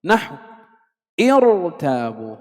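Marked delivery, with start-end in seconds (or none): 1.2-2.4 slow ارتابوا, opening on an i before a thick r; pronounced hamzat wasl, heavy ra. Shown as a demonstration. Teacher starts on the word itself, slow heavy ra. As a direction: start ارتابوا with a voiced i, then hold the heavy ra. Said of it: "هنا لفظنا بهمزة الوصل".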